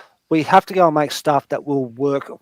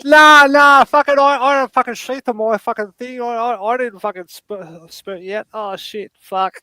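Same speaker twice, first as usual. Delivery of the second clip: a mock flustered dad, much higher, bursting loud then trailing off; caught dad; 7 semitones higher, 4 dB louder than usual. Dad caught out by his son, flustered, much higher. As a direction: play the dad caught red-handed, blurting then fading.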